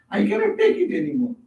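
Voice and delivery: mock despairing wail, pitched high; high anguished cry. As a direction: lift the voice high as if at breaking point. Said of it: "And Sri Ramakrishna cried".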